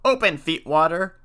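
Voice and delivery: witches' scream. A narrator's reading. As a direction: call out to be let in, a restrained scream. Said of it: "they screamed".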